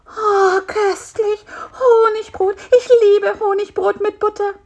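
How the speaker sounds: very high, blissful fly voice; enraptured. Acted. Fly tastes honey bread from spider, enraptured. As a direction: swoon over every bite.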